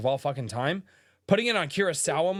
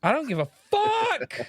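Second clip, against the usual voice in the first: much higher, fed-up voice; pitched way up, done with it; exasperated high outburst; 13 semitones higher than usual. Real, not acted. Exasperated outburst about anime voices.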